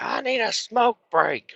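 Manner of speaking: imitating scale operator. Marie asking for a break, high mimicking voice. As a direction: mimic her reply up high.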